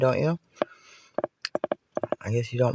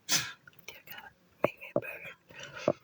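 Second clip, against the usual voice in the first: hushed whispered prayer; whispering; 13 dB quieter, much slower than usual. Pretend.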